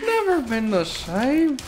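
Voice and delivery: high, mock Irish lament; joining in the lipless Irish bit; lipless Irish accent. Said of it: "Irish and I have no lips".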